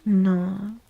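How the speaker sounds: normal talking voice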